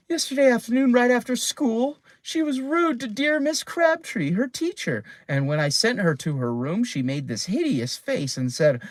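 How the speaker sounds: high, anxious mother complaining; a flustered mother, pitched high; fretful mother. A narrator's reading. Worried mother reports to Piggle-Wiggle.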